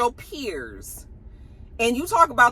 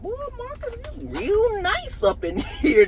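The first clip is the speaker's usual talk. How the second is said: admiring tease; 14 semitones higher than usual. Retold or imitated. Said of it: "I was like".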